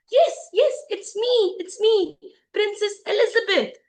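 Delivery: high, loud, eager confirmation; excited insistence. Acted. Princess assuring the prince who she is, excited. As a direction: insist joyfully, words tumbling out.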